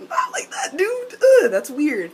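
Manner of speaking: very high, whiny recoil; grossed-out squeal. Acted. Character recoiling from an awkward idea, grossed-out squeal. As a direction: squeal it, then groan in disgust.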